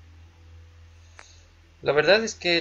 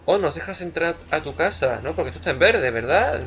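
usual speaking voice

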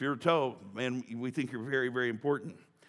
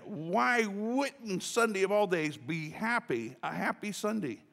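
slow excited exclaim; 7 semitones higher, slower than usual. Real, not acted. Preacher exclaiming joyfully.

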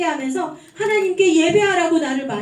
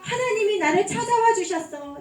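ordinary delivery

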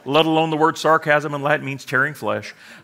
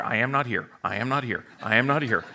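mock denial; 4 semitones lower, 7 dB quieter than usual. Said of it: "the Pentecostal who's like".

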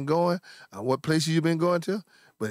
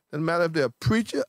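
ordinary talk

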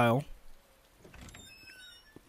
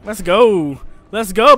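ordinary talk

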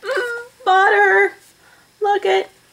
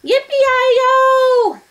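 high, loud, long holler; cowboy whoop; 14 semitones higher, 7 dB louder than usual. A narrator's reading. Cowboy whooping outside the sisters' house, shouted. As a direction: let the whoop ring out high. Cowboy's cry heard again.

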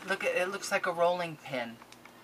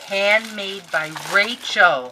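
slow, louder awe; awed; 2 semitones higher, 10 dB louder, slower than usual. Real, not acted. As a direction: savor the name. Reading gift label.

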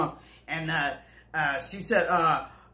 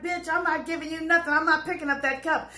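higher defiant refusal; a touch of a stubborn brat; retold refusal; 8 semitones higher than usual.

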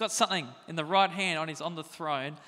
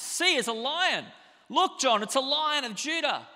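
excited urging; 8 semitones higher, 6 dB louder than usual. Retold or imitated. Elder urging John to look.

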